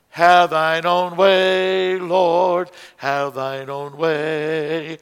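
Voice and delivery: slow, leaning on "Have" at each measure; stress on beat one. Shown as a demonstration. Song leader showing class the downbeat, slow stressed chant. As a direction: press the first beat, drawn out. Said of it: "we're emphasizing beat one".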